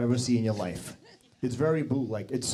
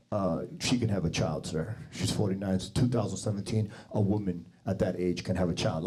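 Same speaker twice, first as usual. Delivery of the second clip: doctor voice; 6 semitones lower than usual. Retold or imitated. Doctor explains calmly.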